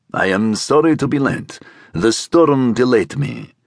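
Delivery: put-on deliberate guttural Egyptian accent. A narrator's reading. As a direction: speak with a throaty Egyptian accent. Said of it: "with a guttural Egyptian accent".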